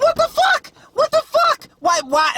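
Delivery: hammed-up shocked yelp, voice cracking up high; mock panicked soldier. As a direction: squeal it like a freaked-out soldier. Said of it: "he's like".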